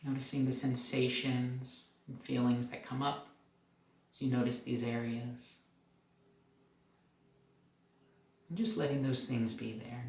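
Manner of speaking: soft, calm meditation guide; guided meditation. Acted. Doctor leading viewers up through the body, calm and soft. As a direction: lead the body scan gently, letting pauses breathe.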